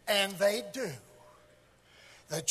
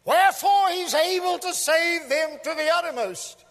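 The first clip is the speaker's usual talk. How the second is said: much higher, pulpit voice; ringing proclamation; 9 semitones higher, 6 dB louder than usual. Real, not acted. Preacher proclaiming salvation to the congregation, ringing and raised. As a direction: lift the verse high over the congregation.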